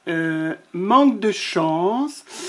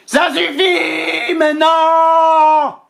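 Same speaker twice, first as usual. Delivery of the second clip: high, loud and slow furious roar; booming lion fury, drawn out; lion's roar; 9 semitones higher, 5 dB louder, much slower than usual. Acted. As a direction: roar it out, stretching each word. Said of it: "rugit le lion".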